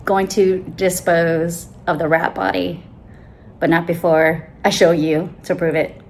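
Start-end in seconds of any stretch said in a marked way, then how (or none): none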